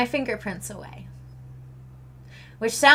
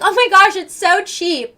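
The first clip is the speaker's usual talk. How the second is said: high-pitched mock delight; gushing tourist; 6 semitones higher, 4 dB louder than usual. Imagined Canadian shopper in America, mock gushing. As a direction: play a visitor gushing over a bargain. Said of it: "we're going around and we're like".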